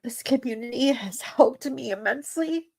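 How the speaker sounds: real tearful talk, voice strained; fighting tears. Real, not acted. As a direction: talk through tears you are trying to hold back. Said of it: "so hard to try and not cry and talk".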